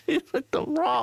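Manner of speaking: high, squeaky laughing speech; real laughter. Real, not acted. Reader cracks up at a crude comment, laughing. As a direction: crack up while getting the words out.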